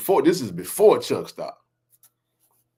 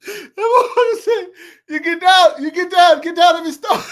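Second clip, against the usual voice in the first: laughing; 7 dB louder than usual. Real, not acted. Retelling being thrown out laughing.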